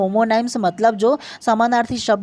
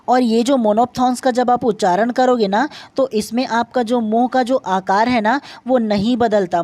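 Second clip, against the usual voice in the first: normal talking voice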